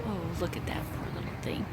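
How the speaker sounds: whispering. Real, not acted. Cooing over baby bunny.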